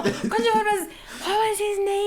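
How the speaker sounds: high, loud, laughing; laughing question. Real, not acted. Asking friend about the boy in the story, laughing question. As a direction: ask through real laughter.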